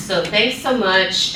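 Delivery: ordinary delivery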